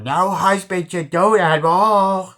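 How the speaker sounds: high, very loud goofy wail; Adam Sandler. Retold or imitated. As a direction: blow the line up into a comic holler. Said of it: "that sounds like Adam Sandler".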